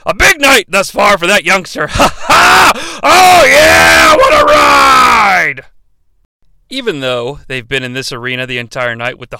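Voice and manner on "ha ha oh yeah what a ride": high, loud, slow thrilled shout; excited yell. Real, not acted. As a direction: whoop with delight. Excited laughing outburst.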